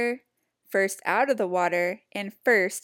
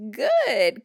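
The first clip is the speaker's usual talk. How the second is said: loud cackling good; cackle; 3 semitones higher, 7 dB louder than usual. A narrator's reading. Small creature cackling at Pinkerton. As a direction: gloat with a cackle. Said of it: "cackled the small creature".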